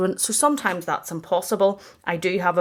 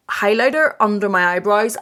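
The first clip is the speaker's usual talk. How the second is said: usual speaking voice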